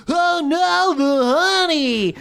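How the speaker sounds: very high, stretched-out goblin wail; honey goblin. Acted. Honey goblins hopping in tandem at the explosion, high dismayed squeal. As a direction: squeal it in cartoon dismay, last word drawn out. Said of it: "the honey goblins begin acting strangely".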